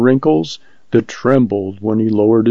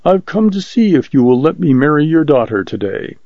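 ordinary delivery, nothing marked

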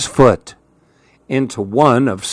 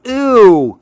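high-pitched cry of disgust; 9 semitones higher, 4 dB louder than usual. A narrator's reading. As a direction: recoil in childish revulsion. Child imagines only broccoli to eat.

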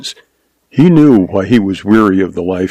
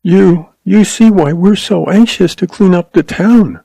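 voice quivering; 9 semitones higher than usual. A narrator's reading. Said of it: "her voice quivering".

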